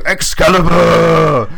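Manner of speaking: slow, loud band-name yell; hammed-up rocker yell; metal frontman shout. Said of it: "lead singer band intro".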